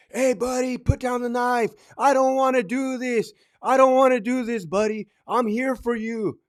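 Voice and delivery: high-pitched, performative plea; pleading cop. Acted. Cop talking down a man with a knife, showy pleading.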